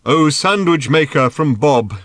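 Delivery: put-on old priest solemnly addressing Arthur; solemn pronouncement. A narrator's reading. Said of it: "he pronounced".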